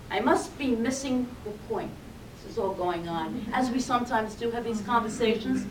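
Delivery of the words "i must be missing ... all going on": ordinary talk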